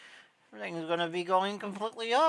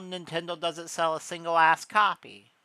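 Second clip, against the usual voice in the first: normal talking voice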